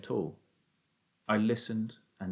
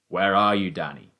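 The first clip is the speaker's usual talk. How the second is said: raised father's voice, falling away; calling out; 3 semitones lower, 6 dB louder than usual. A narrator's reading. Father calls to son in the wood, calling out. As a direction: call out loudly, then drop on the name. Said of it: "my father called out".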